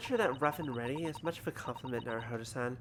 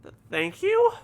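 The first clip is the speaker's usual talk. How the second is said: high, loud stammered thank you; startled squeak; 12 dB louder, slower than usual. Real, not acted. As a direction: squeak it out, caught completely off guard. Baffled by the sailor's threat.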